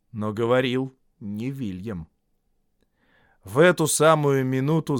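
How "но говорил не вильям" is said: usual speaking voice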